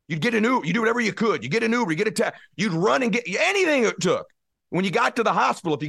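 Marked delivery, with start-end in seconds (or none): none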